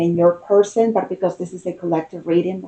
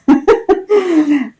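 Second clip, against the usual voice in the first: bright burst of happy laughter; delighted laugh; 9 semitones higher, 4 dB louder than usual. Real, not acted. Delighted by the cards.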